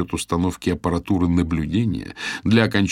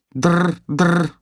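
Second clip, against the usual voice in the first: phone ring imitation; 11 semitones higher, 5 dB louder than usual.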